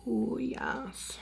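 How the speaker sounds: soft breathy whisper of delight; whispered awe. Real, not acted. Makeup vlogger admiring her blush, in a soft whisper. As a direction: whisper it like a secret treat.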